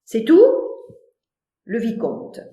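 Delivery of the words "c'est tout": high, loud, calm challenge; coolly unbothered question; unruffled. Acted. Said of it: "Cyrano imperturbable".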